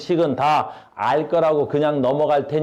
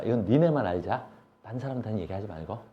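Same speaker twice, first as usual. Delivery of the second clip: secretive hush; 6 semitones lower, 9 dB quieter than usual. Pretend. Teacher shares exam secret.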